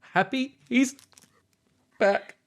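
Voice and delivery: high, strained voice pretending all is fine; a crying innkeeper insisting she's happy; Cora holding back tears. Cora tells the party she's fine, high and tearful. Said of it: "And she's like".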